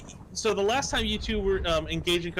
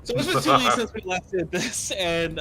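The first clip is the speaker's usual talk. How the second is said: muffled voice, hand over mouth; 3 semitones higher, 4 dB louder than usual. Real, not acted. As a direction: talk through a muffled mic. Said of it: "if I sound a bit muffled".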